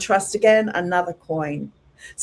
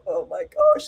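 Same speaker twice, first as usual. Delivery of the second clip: high squeaky aghast reaction; hammed-up shock, very high-pitched; mock dismay. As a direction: imitate your own startled squeak. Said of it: "And I go".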